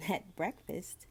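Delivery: ordinary delivery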